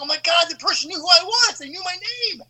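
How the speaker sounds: freaking out to a friend; panicked. Retold or imitated. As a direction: kid telling his friend he was caught, panicked. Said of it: "I told my friend".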